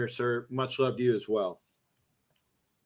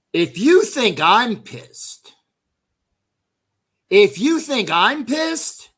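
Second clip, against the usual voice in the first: higher and louder, slow and drawn out; emphatic shout; 6 semitones higher, 5 dB louder, slower than usual. Real, not acted. Telling his friend Wayne he's not really pissed, loud and slow.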